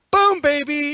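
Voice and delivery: loud, high victory cheer; triumphant shout. Real, not acted. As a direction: whoop at the good roll. Player celebrates a roll.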